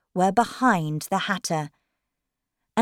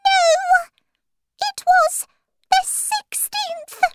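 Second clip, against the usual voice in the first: extremely high, tiny squeak; Dormouse; 5 dB louder, slower than usual. Acted. Dormouse correcting the others, tiny high squeak. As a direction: squeak it out like a little rodent. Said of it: "said the Dormouse".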